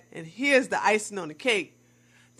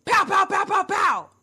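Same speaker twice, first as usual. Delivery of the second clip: imitated gunshots; 8 semitones higher than usual. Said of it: "then I heard".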